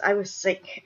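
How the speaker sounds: usual speaking voice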